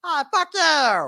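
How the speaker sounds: very high, slow, strangled mock curse; squeaky mocking imitation. Mocking co-host's outburst, drawn-out squeaky high curse. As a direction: drag it out in a silly high squeal to tease. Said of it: "You're just like".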